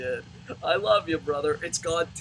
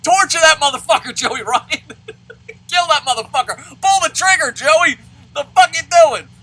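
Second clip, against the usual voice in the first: much louder, higher cheering for violence; bloodthirsty yell; 12 semitones higher, 14 dB louder than usual. Retold or imitated. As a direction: egg on the gunman at full volume. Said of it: "I'm like".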